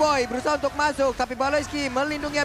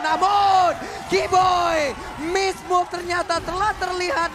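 loud, slow, higher hype call; excited shout; 4 semitones higher, 5 dB louder, much slower than usual. Real, not acted. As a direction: draw it out with rising excitement. Caster reacting to sudden play.

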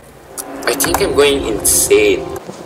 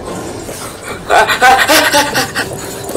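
panicked laugh. Real, not acted. Panicked chase moment.

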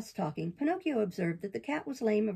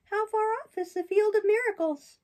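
high, bright-eyed puppet, much higher than the narrator; puppet boy voice; 13 semitones higher, 6 dB louder than usual. Acted. Pinocchio protests to the fairy in a high boyish voice. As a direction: play Pinocchio as an eager young boy defending himself.

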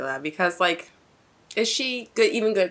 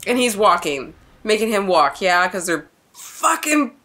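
furious, raised-voice outburst; outraged; 3 semitones higher, 7 dB louder than usual. Real, not acted. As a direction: rail in indignation, exploding on the last words. Outraged at hero's treatment.

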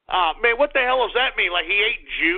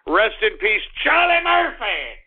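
ordinary talk

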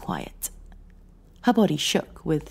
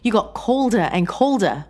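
hurt reproach; 6 semitones higher, 7 dB louder than usual. Acted. Wife pleading her hurt in quarrel.

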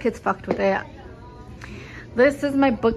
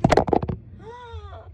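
sharp high gasp of dismay; shocked gasp; 12 semitones higher than usual. Real, not acted. Mom finds husband's wallet in laundry, sharp high gasp. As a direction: gasp as you spot the washed wallet.

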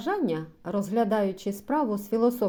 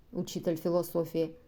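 plain reading voice

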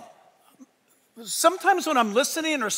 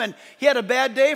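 normal talking voice

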